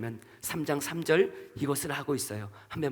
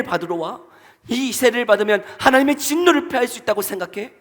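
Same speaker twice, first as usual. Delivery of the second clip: angry rebuke; 5 semitones higher, 8 dB louder than usual. Acted. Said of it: "라고 이야기하고 있는 것이죠".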